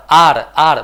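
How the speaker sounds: आर said loud with an open long आ; modelled R sound. Shown as a demonstration. Teacher repeating आर for students, loud and clear. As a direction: say आर firmly, holding the आ. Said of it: "ना बोलते हैं आप आर".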